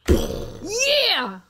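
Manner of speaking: high, loud triumphant yell; loud cheer. Real, not acted. Celebrating a won level with partner, loud yell. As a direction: shout it out in triumph. Cheering after a tense card round.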